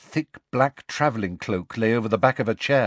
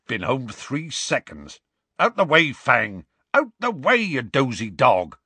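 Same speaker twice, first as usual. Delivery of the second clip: calling to dog; 4 semitones higher than usual. A narrator's reading. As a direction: shoo the dog out of the way. Hagrid calls to his dog.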